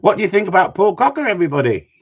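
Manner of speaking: loud, high rallying call to the chat. Real, not acted. Host calling out to his live chat, loud and high.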